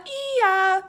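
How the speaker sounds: high, loud braying ee-ya; donkey bray. Acted. The burros arriving below the hill, a high loud bray. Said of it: "a loud ee-ya".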